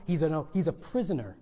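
usual speaking voice

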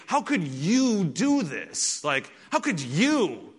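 slow, aching disbelief; mother stunned and wounded by her son; hurt mother. Retold or imitated. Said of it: "Mary is saying to him".

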